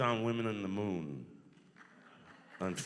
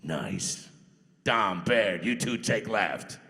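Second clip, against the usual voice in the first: game character line; 2 semitones lower, 9 dB louder, much slower than usual. Pretend. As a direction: give the squad order in character. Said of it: "Do the voice, man".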